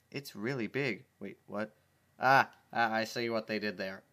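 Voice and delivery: ordinary delivery, nothing marked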